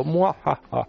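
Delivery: high evil villain cackle; mustache twirling villain. Acted. Mocking the minister's claim.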